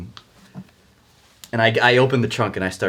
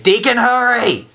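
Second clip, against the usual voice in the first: high, drawn-out holler into an alley; urgent yell; 10 dB louder, slower than usual. Acted. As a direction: yell to hurry a partner along. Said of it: "and I yell, uh, into the alley".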